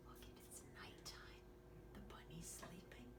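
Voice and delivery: a gasp, then a soft whisper. Acted. Showing the child the sleeping bunny, whispered. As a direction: whisper so the bunny stays asleep.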